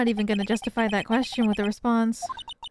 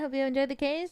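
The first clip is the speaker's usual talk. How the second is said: ordinary talk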